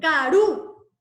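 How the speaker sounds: Karu, loud, with the U stressed; stress on final U. Shown as a demonstration. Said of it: "esa carga adicional en la U".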